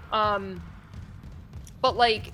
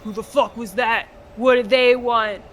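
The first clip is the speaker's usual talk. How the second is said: gruff dad; 7 dB louder than usual. Retold or imitated. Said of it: "your dad's like".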